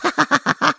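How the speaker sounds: short high mocking laugh; high nerdy giggle. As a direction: giggle at them. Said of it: "Vasily continued".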